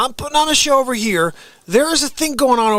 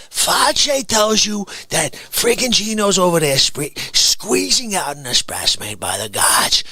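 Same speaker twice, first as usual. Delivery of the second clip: usual speaking voice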